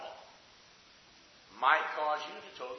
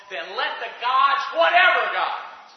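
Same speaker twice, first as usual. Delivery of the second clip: louder, slow, rising oath; furious oath; 5 semitones higher, 11 dB louder, slower than usual. Acted. The queen swearing vengeance on Elijah, slow and loud. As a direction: swear it at full force, much higher. Preacher voices the queen's threat.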